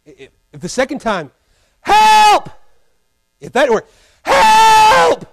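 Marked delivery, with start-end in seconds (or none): 1.8-2.5 high shouted cry for help; frantic yell for rescue; desperate shout. Acted. Drowning swimmer keeps calling. 4.2-5.3 high shouted cry for help; frantic yell for rescue; desperate shout. Acted. Drowning swimmer keeps calling.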